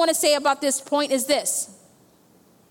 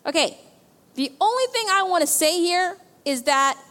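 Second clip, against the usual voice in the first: usual speaking voice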